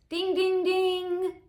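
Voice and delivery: game-show bell. Pretend. Mock correct-answer bell.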